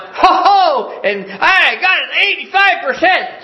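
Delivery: high, loud mock cheer over the grade; gloating celebration. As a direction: crow like a mom showing off her score. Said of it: "just having this great big celebration".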